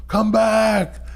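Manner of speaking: high, drawn-out retold holler; calling after someone. Robbed man to the fleeing thief, high imitated call.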